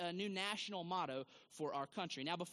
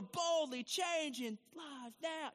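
church motto; 7 semitones higher, much slower than usual. Retold or imitated.

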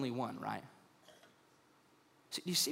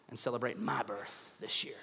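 mock whisper; 10 semitones lower, 8 dB quieter than usual. Mock-vain aside to congregation.

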